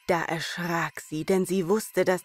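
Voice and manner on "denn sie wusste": plain reading voice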